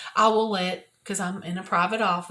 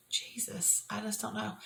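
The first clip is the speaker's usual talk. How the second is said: whispered name, then very soft voice; barely audible, as if praying alone; helpless hushed prayer; 13 dB quieter than usual. Retold or imitated. Speaker re-enacts sitting alone talking to Jesus, helpless hushed prayer.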